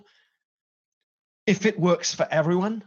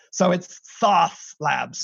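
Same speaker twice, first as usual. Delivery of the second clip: slow "Sauce Labs" in an American accent, with a flat American vowel on "Sauce"; much slower than usual. Shown as a demonstration. Brit forcing an American accent on his company name. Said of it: "the best American accent I can muster".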